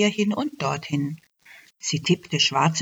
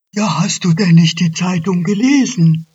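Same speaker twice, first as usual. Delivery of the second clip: much louder, blunt moose voice; scolding moose; 9 dB louder than usual. Acted. Moose lecturing the elf, loud and blunt. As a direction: boom at her like she should know. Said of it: "fragte der Elch".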